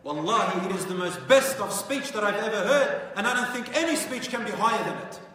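loud, high and awestruck; awed loud praise. Acted. Al-Walid to the Quraysh leaders, loud and awestruck. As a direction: declare it the greatest speech you've heard.

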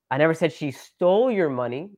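weight on "stole", tune left rising at the end; stress on STOLE. Shown as a demonstration. Suggesting maybe she borrowed it, stress on "stole". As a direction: punch "stole" in mid-sentence. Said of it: "Maybe she borrowed it".

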